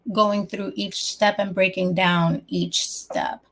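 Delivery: ordinary talk, nothing marked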